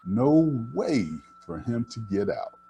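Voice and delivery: usual speaking voice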